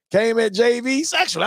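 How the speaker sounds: ordinary talk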